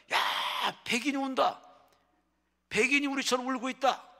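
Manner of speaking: high, loud shout across a village; yelling out news. Retold or imitated. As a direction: holler it like an excited villager raising the alarm. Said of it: "막 고래고래 지르 소리칩니다". (마을에 대고 고래고래 외치듯)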